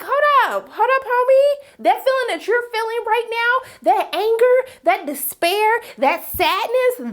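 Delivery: high, forceful interruption; acted confrontation. June turning the pain back on Serena, high and emphatic.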